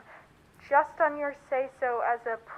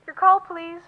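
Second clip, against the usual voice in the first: operator on the line; 5 semitones higher, 9 dB louder than usual. Acted. Operator speaking over phone line.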